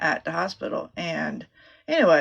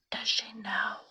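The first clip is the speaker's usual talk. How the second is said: hushed whisper; husband whispering at the front desk; 3 semitones higher, 9 dB quieter than usual. Retold or imitated. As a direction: whisper it so she can't hear.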